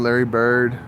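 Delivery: ordinary talk, nothing marked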